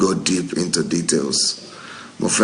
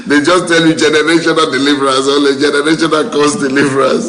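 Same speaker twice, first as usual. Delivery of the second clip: fast, louder amused jab; excited; 7 semitones higher, 5 dB louder, faster than usual. Real, not acted. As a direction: rattle it off fast and loud, mocking. Amused rant continues.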